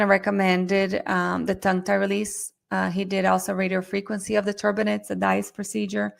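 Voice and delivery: ordinary talk, nothing marked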